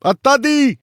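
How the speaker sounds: very high, loud panicked Russian yell; hysterical yell in Russian. A narrator's reading. Soldier screaming that a man is dead, hysterical. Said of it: "a single voice crying".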